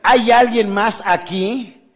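high, loud, slow appeal; desperate retold plea. Speaker retelling the hanging man's plea to the audience, slow and loud. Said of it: "¿Qué, qué dijo?".

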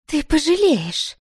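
high, drawn-out squeak of a threat; tearful squeak. A narrator's reading. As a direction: act her squeaking after swallowing a sob. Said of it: "вдруг пискнула она". (писклявый выкрик сквозь подавленный всхлип)

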